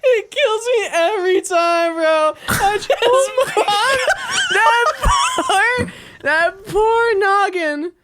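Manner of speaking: high, laughing through tears; cracking up, barely getting words out; laughing hard. Real, not acted.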